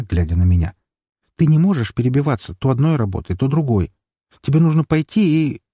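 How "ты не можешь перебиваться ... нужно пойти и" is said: higher-pitched sister, urging; lecturing sister. Acted. Sister tells her brother to stop drifting between jobs, lecturing in a higher voice. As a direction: admonish him in a raised-pitch sister voice.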